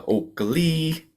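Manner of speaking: high drawn-out Okalee; bird song imitation. Shown as a demonstration. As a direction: whistle Okalee like the blackbird's call. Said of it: "Some people say".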